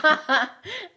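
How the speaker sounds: loud high laugh. Real, not acted. Laughing at sarcastic remark.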